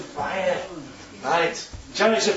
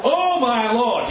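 slow dismay; 6 semitones higher, 4 dB louder, much slower than usual. Acted. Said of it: "the servant asked".

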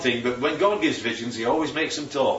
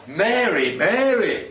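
earnest doubled name, rising pitch on the repeat; intense call; 5 semitones higher, slower than usual. Acted. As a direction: call her name insistently, higher the second time. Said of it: "it's intense".

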